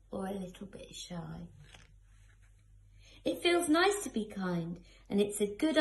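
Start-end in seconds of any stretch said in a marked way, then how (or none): 0.1-1.5 soft whisper for the shy ones. Acted. Reading to children, whispering about shy little ones. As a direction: hush it like a secret for little ones.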